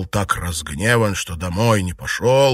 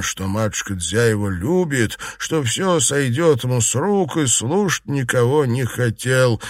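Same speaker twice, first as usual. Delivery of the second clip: ordinary delivery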